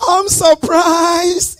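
high, slow mock surprise; a hammed-up surprise at the gift. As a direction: stretch it out in wonder. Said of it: "you go like".

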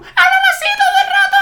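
squeaky high cartoon chipmunk; sped-up squeaky chipmunk voice, laughing. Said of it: "Que lo hace súper bien".

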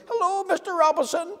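high tiny voice; a tiny man calling up from the doorstep; little voice. Acted. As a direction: squeak it like someone as small as a pin. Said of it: "he heard a little voice".

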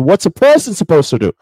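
acted cry of a person under pressure; pressure boiling over. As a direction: burst out, at wit's end. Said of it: "how the pressure starts to build up".

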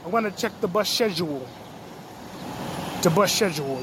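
put-on British accent, "schedule" said with a SH. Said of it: "I got the wrong accent".

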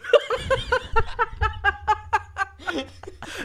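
long wheezing laugh; high breathless laugh. Real, not acted. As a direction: cackle with no breath. Group laughing at the joke.

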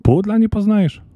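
high, offended protest; high-pitched wounded dignity; indignant elf. A narrator's reading. Elf offended at being called rat.

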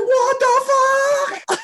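very high, drawn-out mock shriek; shocked outcry. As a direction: re-enact a stunned squeal, stretching every word. Acts out own shock at blast.

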